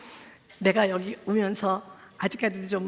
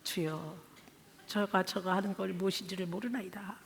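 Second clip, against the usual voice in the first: quiet prayer; 3 semitones lower, 10 dB quieter than usual. Retold or imitated. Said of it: "기도했어요".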